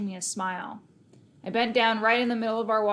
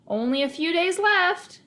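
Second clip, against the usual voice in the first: high, smug sister teasing; lightly voiced gloating big sister; grinning taunt; 8 semitones higher, 4 dB louder than usual. A narrator's reading. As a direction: needle him with a grin in your voice. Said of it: "she grinned".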